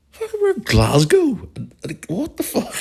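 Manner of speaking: very high, squeaky incredulity; hammed-up, drawn-out amazement at being recognised; mock disbelief. Dad going to himself after being spotted, hammed-up disbelief.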